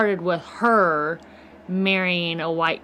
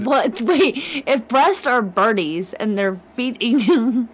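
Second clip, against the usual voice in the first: laughing disbelief, louder; 4 semitones higher, 5 dB louder, slower than usual. Real, not acted. Laughing at the dirty joke.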